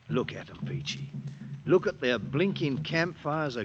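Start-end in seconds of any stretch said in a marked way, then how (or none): 0.1-1.1 low, breathy awed whisper; man in the dark marvelling; hushed whisper. Acted. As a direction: whisper it in wonder. Quiet night, gazing at campfires.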